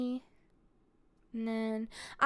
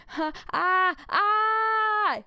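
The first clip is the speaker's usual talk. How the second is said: loud, high, drawn-out "ah"; mock panicked scream; 8 semitones higher, 4 dB louder, much slower than usual. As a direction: act out a wordless panicked yell. Acts out own panicked yelling.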